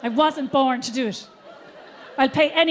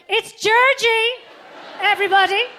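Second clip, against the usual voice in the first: announcing to the crowd; 8 semitones higher, 6 dB louder than usual. Retold or imitated. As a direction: proclaim it to the whole theatre. Potter announcing to audience.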